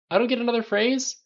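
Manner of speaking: high, loud complaining question; crying out. A narrator's reading. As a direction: protest as if short-changed. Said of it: "Tony cried".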